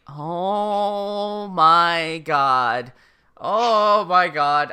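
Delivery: very drawn-out words, hammed-up despair; exasperated groan. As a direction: stretch every word in mock agony. Elle exasperated by the father's denial.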